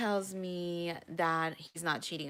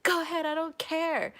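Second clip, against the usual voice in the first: defiant; 10 semitones higher, 3 dB louder than usual. Retold or imitated. Defiant reply to cops threat.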